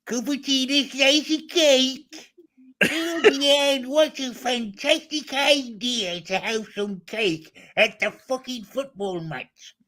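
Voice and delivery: high, slow, drawn-out put-on voice; George's voice. Performing the cake order on a friend's request, high drawling impression. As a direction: stretch every word in a high mock voice. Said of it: "do that in George's voice".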